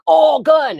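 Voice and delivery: high-pitched alarmed yelp; panicked cry. Retold or imitated. Storyteller spotting a possible gun, acted panic. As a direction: blurt it out in mock panic.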